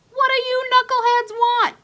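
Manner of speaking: put-on high, loud boy's shout; yelled out a window. Ace leaning out the window, yelling down at the bullies. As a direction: shout down to the street.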